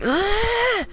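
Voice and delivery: high, held squeal of frustration; imitated frustrated scream. Classmate squeezing his head in anger, imitated high scream. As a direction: mimic a strained scream without much volume. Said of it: "starts screaming and going".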